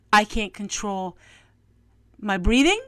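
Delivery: normal talking voice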